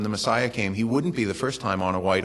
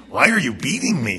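put-on a light Mexican accent on the donkey's plea; 3 semitones higher than usual. Preacher quotes the radio song's donkey in a Mexican accent. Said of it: "the donkey has a Mexican accent".

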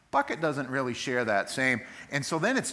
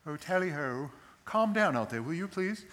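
mock-British politeness, pitched up; hammed-up British gentility; genteel British; 5 semitones higher than usual. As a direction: parody a proper Englishman asking for calm. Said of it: "That's King James beautified. 'Cause he didn't say".

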